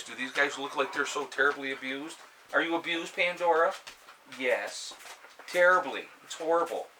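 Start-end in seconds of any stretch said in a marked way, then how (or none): 2.5-3.7 higher, playful pet talk; talking to the dog. Real, not acted. Owner asking his dog Pandora, playful pet talk. 4.4-6.9 sarcastic answer. Pretend. Owner answering for his dog, mock tragic.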